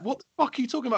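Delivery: high, mock-indignant girlfriend voice; retold angry girlfriend. Missus snapping back at him over the running order, high mock outrage. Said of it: "And she was like".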